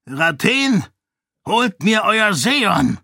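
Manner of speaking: high, sharp order from the zealous monk; commanding Dilaf. A narrator's reading. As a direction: bark the command up high and loud. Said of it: "befahl Dilaf". (hoher, befehlender Ton)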